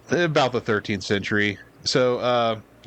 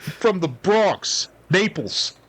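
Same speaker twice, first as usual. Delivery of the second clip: put-on very slow high Bronx Italian accent; New York Italian accent; 6 semitones higher, much slower than usual. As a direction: stretch it like a Bronx guy. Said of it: "New York Italian accent".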